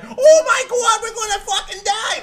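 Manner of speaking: hammed-up plane-crash panic yell; retold panicked scream. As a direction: shriek it like a panicking passenger. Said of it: "Fucking screaming at the long line".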